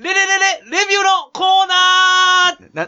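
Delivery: high, loud stuttering build into a drawn-out yell; segment title shout. Shown as a demonstration. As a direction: belt the title out like a jingle. (コーナー名を高らかに絶叫)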